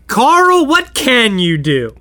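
high, loud burst on the name; mock exasperation, slowing into the question; exasperated. Cast demanding what Carl can do, exasperated outburst.

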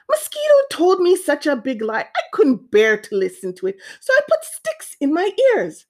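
lightly voiced exasperated iguana; iguana explaining himself. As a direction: pitch leaping high in complaint.

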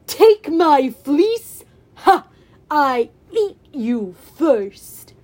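loud, very slow, mocking monster; menacing Cyclops. Cyclops rises to devour hero, slow mocking menace. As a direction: boom the threat word by word.

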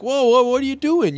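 high protesting whine; mock whiny Robert. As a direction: plead with mom, hurt.

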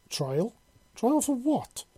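rising disbelief, leaping high on the second question; incredulous questioning. A narrator's reading. Prisoner told he faces trial, incredulous. Captive baffled by talk of trial.

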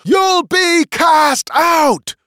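high, slow threatening shout; loud, drawn-out furious yell. A narrator's reading. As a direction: bellow a threat after a fleeing boy. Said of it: "shouted the magician after him".

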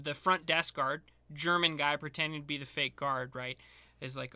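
ordinary talk, nothing marked

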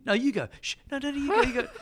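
high, giggly "you go, no, you go"; giggly family nudging. Retold or imitated. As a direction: imitate relatives pushing each other to go over. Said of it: "and you hear".

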